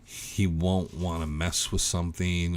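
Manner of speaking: ordinary talk, nothing marked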